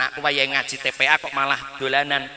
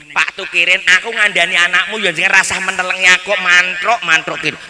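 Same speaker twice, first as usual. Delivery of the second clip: mock scolding; 4 semitones higher, 7 dB louder than usual. Quoted complaint to Pak Tukirin.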